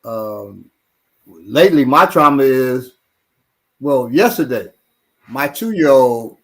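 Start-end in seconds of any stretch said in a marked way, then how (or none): none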